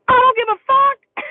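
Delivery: high, loud defiant yell; defiant shout. Retold or imitated. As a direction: scream it out in defiance. Defiant outburst in a retold fight.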